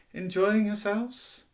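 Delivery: high, slow, drawn-out question; genial host. A narrator's reading. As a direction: ask guests grandly, pitched right up. Ghost host greets guests.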